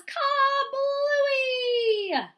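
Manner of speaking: high, loud burst word; big kablooey. A narrator's reading. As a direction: shout the pop like a splash. Balloon bursts.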